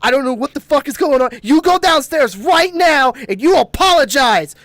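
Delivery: loud, high-pitched scolding, building to a shout; re-enacted yelling. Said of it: "I started yelling at him".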